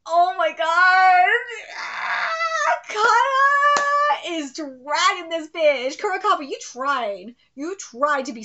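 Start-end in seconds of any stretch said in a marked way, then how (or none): none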